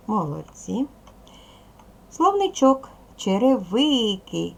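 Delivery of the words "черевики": черевики drawn out slowly with stress on ви; stress on third syllable. Shown as a demonstration. Teacher shows children the word's stressed syllable, stretching ви in черевики. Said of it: "Наголос падає на третій склад ви".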